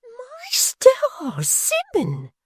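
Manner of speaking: very high, put-on shocked falsetto; affected astonishment. A narrator's reading. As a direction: fake a gasp of shock. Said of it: "in affected astonishment".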